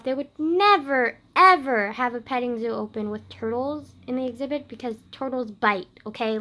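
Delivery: loud, emphatic disbelief; indignant. Real, not acted. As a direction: come down hard on "never, ever". Indignant rant about dream's absurdity.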